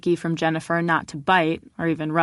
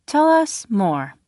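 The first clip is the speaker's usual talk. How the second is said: slow, bright TV reporter delivery; mock news anchor asking for more; 6 semitones higher, 4 dB louder, slower than usual. A narrator's reading. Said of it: "with her reporter voice".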